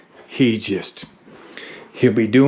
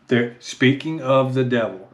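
usual speaking voice

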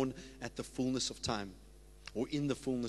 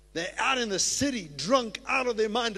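getting excited; 7 semitones higher, 5 dB louder than usual. Real, not acted. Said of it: "I'm getting a little excited".

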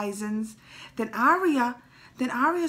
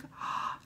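gasp; 6 dB quieter than usual. Retold or imitated. Reacting to dragon sparing Jon.

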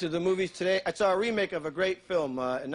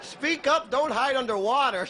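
higher, lightly raised voice; mock scolding call; 7 semitones higher, 4 dB louder, slower than usual. Comedian to a drowning swimmer he can't hear, mock scolding call. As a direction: call out a comic reprimand.

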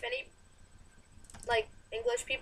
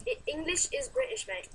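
higher mock-British voice; British accent; 6 semitones higher than usual. Shown as a demonstration. Arguing with a friend over English, higher British accent. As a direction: argue in a put-on British accent. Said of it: "how my accent is".

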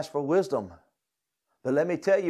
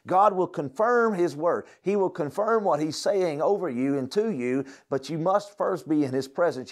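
preaching at full force; 6 semitones higher than usual. Acted. Pastor urging students to seek God, preaching at full force. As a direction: drive the point home to the room.

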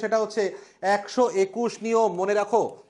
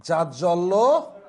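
drawn out very slowly, a lower pitch; slow careful model word; 4 semitones lower, much slower than usual. Shown as a demonstration. Teacher spells it out for students, slow and low. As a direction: stretch each syllable of জাজ্জল্যমান for the class. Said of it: "নিচে একটি ব থাকবে".

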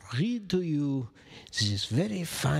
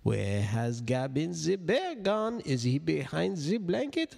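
ordinary delivery

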